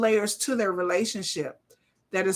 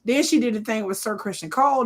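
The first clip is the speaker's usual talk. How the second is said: ordinary talk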